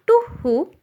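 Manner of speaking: high-pitched spoken owl hoot; teacher's lightly imitated owl call. Shown as a demonstration. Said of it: "makes the sound to who".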